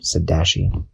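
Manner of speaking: ordinary delivery, nothing marked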